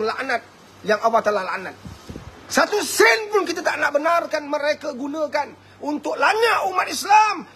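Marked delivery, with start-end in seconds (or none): 2.5-7.5 angry shout. Real, not acted. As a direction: roar it out in outrage. Preacher rising to fury.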